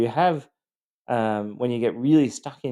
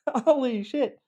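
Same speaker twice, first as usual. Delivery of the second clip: very high, amazed repeat of holy shit; amazed echo; slower than usual. Retold or imitated. Coach replaying the victim's shock, very high and amazed. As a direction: squeak it out in disbelief. Realising trauma memories exist.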